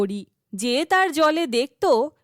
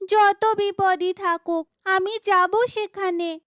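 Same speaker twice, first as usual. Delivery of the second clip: determined heroine; 6 semitones higher than usual. Acted. Heroine vows to go.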